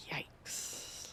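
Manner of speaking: whispered yikes, barely audible; under her breath. Acted. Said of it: "says under her breath".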